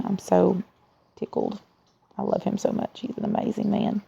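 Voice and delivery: quiet, heartfelt, almost whispered; tender and soft. Real, not acted. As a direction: speak softly with real affection. Tender words about her husband.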